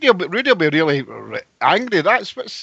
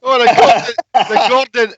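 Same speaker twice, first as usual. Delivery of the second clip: loud greeting; 7 semitones higher, 5 dB louder than usual. Real, not acted. Greeting on joining show.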